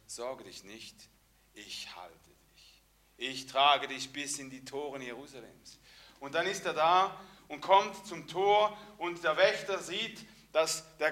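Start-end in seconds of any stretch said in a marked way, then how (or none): 0.1-5.8 softer, calm and comforting; gentle reassurance. Retold or imitated. Envoy soothing the frightened cripple, soft and gentle. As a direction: hold him up with your voice. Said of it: "Er sagt ihm vielleicht".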